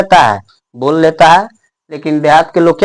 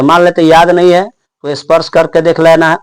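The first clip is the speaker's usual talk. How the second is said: usual speaking voice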